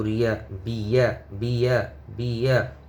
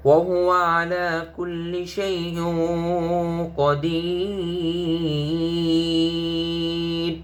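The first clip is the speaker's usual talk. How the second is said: higher, slow melodic tilawah with long drawn قدير; Quran recitation; 6 semitones higher, much slower than usual. Acted. Teacher reciting the full verse slowly for students. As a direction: chant it with stretched madd.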